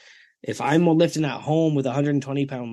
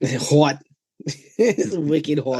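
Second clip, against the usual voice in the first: hammed-up Boston "wicked" talk; amused, put-on Boston accent; mock Boston accent; 3 semitones higher, much slower than usual. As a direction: play the Bostonian for a laugh. Joking Boston take on the nickname.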